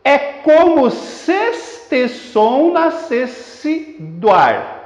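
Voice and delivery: syllable-by-syllable scansion. Shown as a demonstration. Teacher demonstrating scansion to students, syllable by syllable. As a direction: count out every syllable of the verse. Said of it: "Fazendo a escansão, você teria isto aqui".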